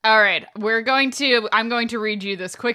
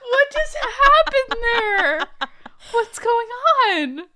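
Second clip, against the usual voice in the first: laughing hard; 4 dB louder, much slower than usual. Real, not acted. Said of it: "What is that?".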